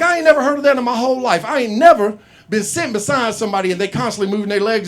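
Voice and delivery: loud, high, fast comic disbelief; worked-up mock outrage; incredulous rant. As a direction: protest loudly, rushing the words. Acted disbelief at made-up syndrome.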